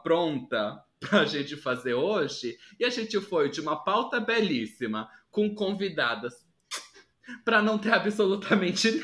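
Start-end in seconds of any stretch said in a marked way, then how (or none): none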